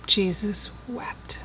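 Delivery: hushed whisper. Acted. Preacher to congregation, reciting the verse in a reverent whisper.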